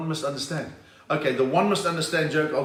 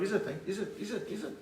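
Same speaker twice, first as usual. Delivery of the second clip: higher, softer repeated challenge; quiet, raised-pitch goading between rivals; mock boxer stare-down; 5 semitones higher, 8 dB quieter than usual. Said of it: "They look at each other going like".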